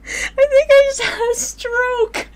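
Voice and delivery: high slow laughing wail. Real, not acted.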